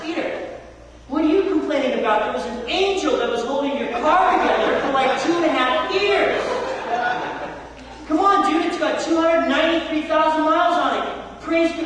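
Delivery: rally a friend with loud amazement; exhorting friend. Retold or imitated. To complaining roommate Spencer, a loud excited rebuke. Pastor retelling his own exhortation.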